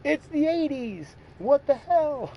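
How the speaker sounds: very high, loud disbelief; mock-appalled high-pitched outburst; retold shock. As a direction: exclaim it in hammed-up astonishment. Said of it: "I'm like".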